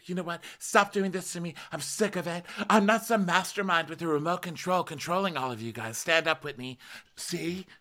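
imitating Lisa. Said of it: "And Lisa's like".

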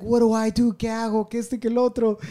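high, frantic mock panic; freaking out. Imitating a cousin woken for the birth, freaking out. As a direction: imitate someone flailing for what to do. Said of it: "he's freaking out".

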